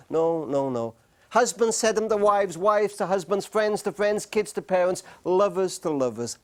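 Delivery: ordinary delivery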